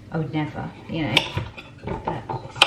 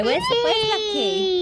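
slow, high, raised call for Lucky; anxious calling; 12 semitones higher, slower than usual. Real, not acted. Looking for the chick Lucky, calling out. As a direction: call out worried, looking for her.

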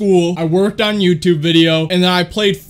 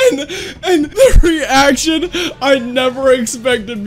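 laughing while talking; 7 semitones higher than usual. Real, not acted. Still laughing while telling.

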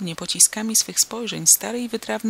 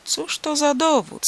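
much higher and slow, mocking doubt; ironic; 8 semitones higher than usual. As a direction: draw it out with sly irony. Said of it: "ironicznie mrużąc oczy".

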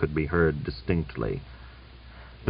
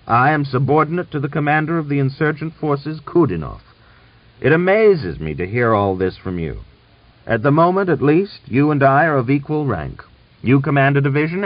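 loud, raised, insistent retort; heated defiance; 8 semitones higher, 5 dB louder than usual. A narrator's reading. Officer claiming equal rank with the general, defiantly. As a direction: assert equal rank with force.

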